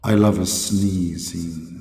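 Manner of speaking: low, very slow echoing voice; deep drawn-out proclamation with echo; Allah. Acted. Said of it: "sound effect".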